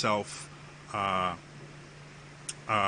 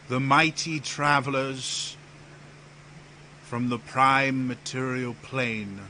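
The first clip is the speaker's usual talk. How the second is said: grandiose herald Theus; 3 semitones higher, slower than usual. Acted. Theus presents the travelers to Empress and Prince, grandiose.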